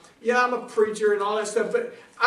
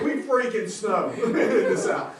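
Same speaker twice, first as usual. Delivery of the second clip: normal talking voice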